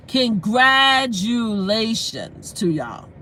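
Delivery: congratulations stretched out syllable by syllable; half-speed, deliberate congratulations to y'all; emphatic, drawn-out. Real, not acted. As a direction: say CONGRATULATIONS very slowly, each syllable held. Drawn-out repeat from the heart.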